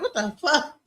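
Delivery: high, baffled retold reaction; amused disbelief. Visitor realizing the locals were joking, baffled.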